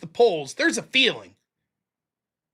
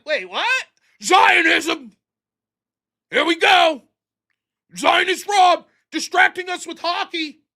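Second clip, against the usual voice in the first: louder, higher sarcastic shout; mock outrage; 4 semitones higher, 3 dB louder, slower than usual. Mocking his own reaction to chat, loud sarcastic outrage. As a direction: ham up fake alarm at the accusation. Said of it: "And I'd be like".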